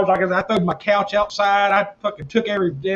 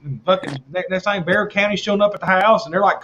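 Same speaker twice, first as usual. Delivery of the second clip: ordinary talk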